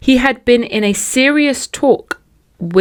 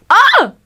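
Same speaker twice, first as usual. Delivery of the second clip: high, loud cry of frustration; frustrated yell; 6 dB louder than usual. Real, not acted. Listener yelling out in frustration at the story. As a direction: let out a sharp ah.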